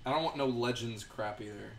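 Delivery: ordinary delivery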